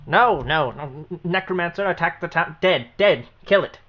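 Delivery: commanding shout. Acted. GM voicing villain ordering attack.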